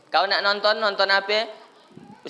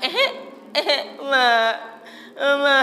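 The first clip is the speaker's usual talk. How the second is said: put-on high whiny child voice; child whining; 7 semitones higher, slower than usual. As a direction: mimic a fussy kid begging.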